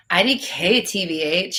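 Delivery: normal talking voice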